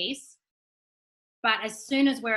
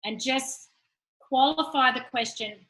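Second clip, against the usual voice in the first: usual speaking voice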